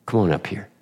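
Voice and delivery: usual speaking voice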